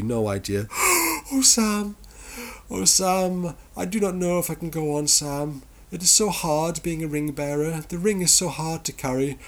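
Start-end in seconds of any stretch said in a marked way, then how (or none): 0.7-1.3 a high, theatrical gasp; mock high gasp. Mock Frodo gasps. 1.3-9.4 high, melodramatic moaning voice, a bit fast; whiny Frodo. Retold or imitated. Mock Frodo laments to Sam.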